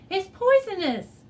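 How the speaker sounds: high, loud screech; alarmed screech at the poisonous frog. A narrator's reading. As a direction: shriek a warning. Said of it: "Maury screeched".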